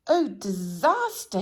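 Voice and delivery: loud, terrified cry of alarm; panicked scream. A narrator's reading. Nervous Mister Jelly screams at the tramp's face, terrified. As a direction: wail it out in a panic. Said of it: "he screamed".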